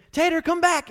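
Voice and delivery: calling out across the woods; calling the dog. Retold or imitated. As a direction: shout the dog's name to bring her back. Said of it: "we're calling her".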